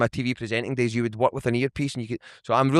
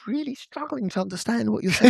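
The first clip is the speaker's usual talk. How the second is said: lightly put-on higher voice; slightly hammed-up bafflement, higher than usual; mock struggling listener; 9 semitones higher than usual. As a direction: imitate a baffled listener, pitched up. Quotes people back home complaining.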